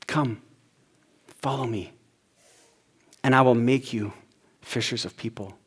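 a hushed, tender call; gentle invitation. Retold or imitated. Pastor voicing Jesus' call to disciples, softly reverent.

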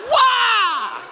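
high loud karate yell; full-force kiai; karate shout. Shown as a demonstration. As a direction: put your whole body into it. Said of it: "You have to go".

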